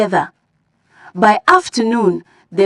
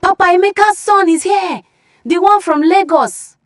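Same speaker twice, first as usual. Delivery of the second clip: excited scream; 8 semitones higher than usual. Acted. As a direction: shout the news across the village. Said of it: "She screamed".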